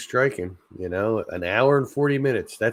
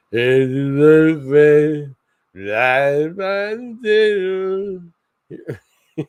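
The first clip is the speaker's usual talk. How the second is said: put-on higher, louder, very slow slurred drunk toast; drunk singalong; 7 semitones higher, 7 dB louder, much slower than usual. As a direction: slur it like a bar full of drunks. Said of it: "bunch of drunk people going".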